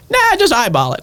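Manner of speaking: high, drawn-up offhand "nah"; dismissive grandma. Retold or imitated. Grandma brushing off request for exact measurements, high and dismissive. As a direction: imitate grandma waving off the question.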